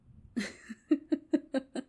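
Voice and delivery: high, whispered giggle; girl's whispered laugh. Acted. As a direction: giggle high under your breath. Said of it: "She looked at them and laughed".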